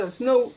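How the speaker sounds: "snoot" said with a long "oo", the fixed word; corrected word. Shown as a demonstration. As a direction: land on "snoot" clearly, stretching the oo. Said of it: "whatever you call it".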